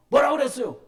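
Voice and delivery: loud, high furious demand; angry shout. Retold or imitated. Angry student shouts at the pastor. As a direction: yell it at the pastor.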